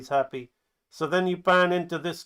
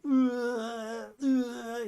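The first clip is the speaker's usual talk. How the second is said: high drawn-out mock groan; pained grunt; 8 semitones higher than usual. Player knocked down going for the kick, mock groaning.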